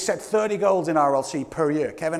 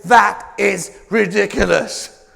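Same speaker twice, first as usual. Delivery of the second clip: slow emphatic; 6 dB louder, much slower than usual. Real, not acted.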